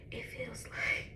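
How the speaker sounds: whispered, voice about to break; choked-up whisper. Real, not acted. Fighting tears while telling viewers about her job, whispered. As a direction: hold back tears on a breath.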